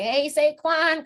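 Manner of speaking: put-on high, slow old-lady call; high drawn-out old-timer hello; old lady greeting. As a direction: greet him like a real old fan. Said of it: "I'm gonna be like".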